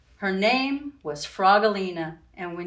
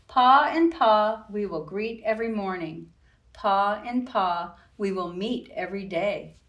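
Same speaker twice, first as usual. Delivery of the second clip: put-on reading to children. A narrator's reading. Reading picture book aloud.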